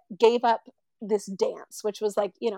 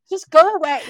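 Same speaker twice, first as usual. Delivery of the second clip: high, loud exasperated plea; 6 semitones higher, 11 dB louder than usual. Retold or imitated. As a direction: throw up your hands. Soldiers giving up on her.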